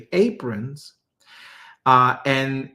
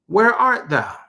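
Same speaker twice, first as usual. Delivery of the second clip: a raised, higher call into the garden; God calling out; 7 semitones higher, 4 dB louder than usual. Acted. God calls out to hiding Adam, raised searching call.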